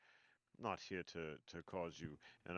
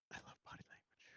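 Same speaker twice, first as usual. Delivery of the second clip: whispered aside; 12 dB quieter, much faster than usual. Real, not acted. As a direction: breathe it out quietly.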